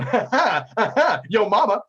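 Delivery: loud, high gloating laugh; mocking taunt. Captain taunts rival in rap battle, mocking laughter. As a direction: gloat with a big mock laugh.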